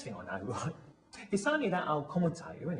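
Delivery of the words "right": normal talking voice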